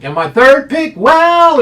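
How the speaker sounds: high, loud hyped-up call; excited hype. Real, not acted. Handicapper hyping his second pick, loud and high. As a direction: sell the pick like a showman.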